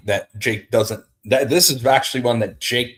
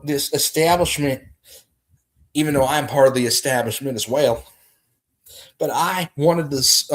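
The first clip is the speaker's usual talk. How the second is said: higher, raspy-free presidential Clinton voice, folksy and earnest; Bill Clinton impression; 4 semitones higher than usual. Streamer mocks Clinton approving his message, presidential impression. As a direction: play Clinton giving a campaign speech. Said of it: "That's my impression of Clinton".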